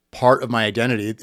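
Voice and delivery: ordinary talk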